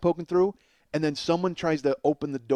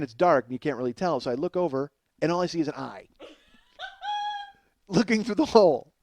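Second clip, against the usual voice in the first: ordinary delivery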